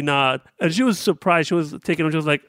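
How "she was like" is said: ordinary talk, nothing marked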